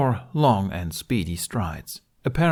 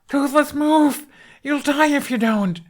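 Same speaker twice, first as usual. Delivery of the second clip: high, louder panicked shout; desperate scream; 5 dB louder than usual. Acted. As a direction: scream at him to save himself. Hiccup screaming at the dragon.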